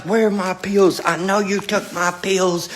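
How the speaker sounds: imitating dad's angry accusation, high-pitched; retold accusation, pitched well up; pissed-off dad. Dad accuses his son of taking his pills, pissed off. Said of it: "So he was pissed".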